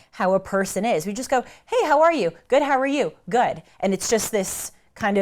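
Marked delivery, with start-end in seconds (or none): 1.7-3.6 lilting, throwaway small-talk pleasantry; breezy greeting. Acted. Americans swapping hellos without really asking, breezy. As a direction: toss it off cheerily, not really asking. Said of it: "we kind of fly through it".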